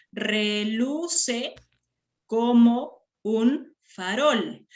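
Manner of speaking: slow verse, syllable by syllable; firm, segmented counting read. Shown as a demonstration. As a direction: break the line into drawn-out, separated syllables. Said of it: "vayamos a contar sílabas".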